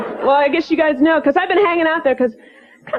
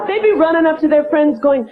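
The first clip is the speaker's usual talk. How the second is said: normal talking voice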